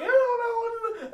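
very high, squealed mock voice; silly group voice. Friend recalling a silly night, high squeal. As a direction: squeal it out as nonsense. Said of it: "we were just like".

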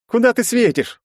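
high, loud irritated grumble; grumbling. A narrator's reading. Герцен grumbles at the man aiming the light, irritated. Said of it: "проворчал Герцен".

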